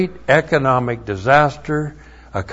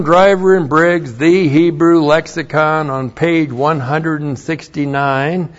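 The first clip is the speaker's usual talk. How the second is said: usual speaking voice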